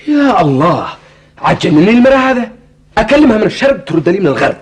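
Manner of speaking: loud, high, fast exasperation; exasperated outburst. Acted. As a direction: throw up your hands, fed up.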